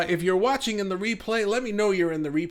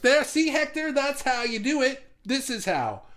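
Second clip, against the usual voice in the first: higher-pitched playful scolding; mock told-you-so, pitched up; mock lecturing; 9 semitones higher, 3 dB louder than usual. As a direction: tease them as if teaching a lesson. Teasing a viewer with mock lesson.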